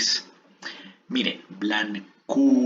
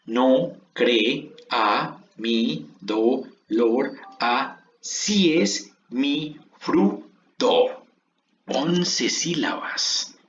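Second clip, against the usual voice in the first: slow syllable reading; much slower than usual. Shown as a demonstration. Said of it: "Veamos cuántas sílabas quedaron por línea".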